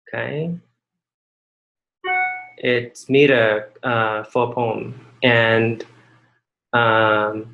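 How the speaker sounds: usual speaking voice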